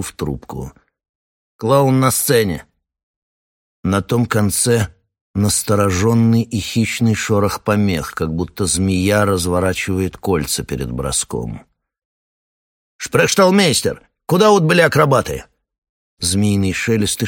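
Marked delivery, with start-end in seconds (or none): 1.6-2.7 another man's voice. Acted. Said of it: "голосом человека, которого я убил". 13.0-15.4 loud, high call into the receiver; calling over radio. Acted.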